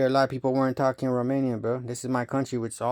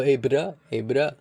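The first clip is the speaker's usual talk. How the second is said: imitating the Spaniard; 2 semitones higher, 5 dB louder, slower than usual. Mocking the Spanish guy at the rave, lightly voiced and louder. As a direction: mimic the guy from the rave calling "bro". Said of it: "Nobody that speaks English talks like that".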